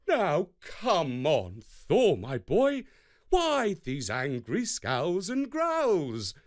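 a god solemnly teasing Thor into a dress; Heimdall's advice. A narrator's reading. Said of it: "This is what he said".

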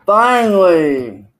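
loud, drawn-out finally; exasperated shout. Real, not acted. Greeting the rival who finally showed, drawn-out shout. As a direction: let it out long after the wait.

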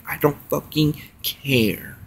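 hushed and slow, a bitter whisper; slow whispered dismissal. Real, not acted. Dismissive rant aside.

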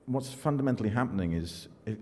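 ordinary talk

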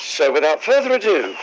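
ordinary delivery

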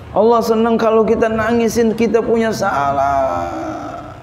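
high, impassioned sermon delivery; preaching. Real, not acted. Preaches at raised pitch. (khotbah berapi-api, nada tinggi)

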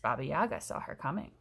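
ordinary delivery

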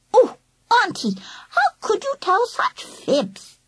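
put-on high lisping little-girl scolding; chiding toddler. A narrator's reading. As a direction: chide playfully like a three-year-old. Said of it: "lisping like a three-year-old".

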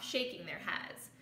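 ordinary delivery, nothing marked

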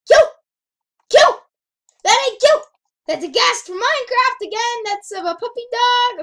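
high, very loud outburst; loud, high-pitched exclaiming; shouted disbelief. Real, not acted. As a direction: yell in shocked protest. Outraged at game's so-called cute creature.

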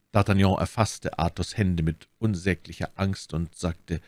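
ordinary delivery

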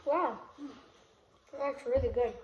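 speaking between bites; talking while chewing. Real, not acted. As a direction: mouth partly full of fish. Seen 0: All three are eating fish; the speaker is unclear; the man's cheeks look full.

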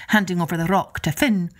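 ordinary delivery

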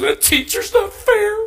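high, slow, mock sobbing complaint; imitating crying wife. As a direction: play the wife tearfully whining about the teacher. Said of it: "She's actually crying".

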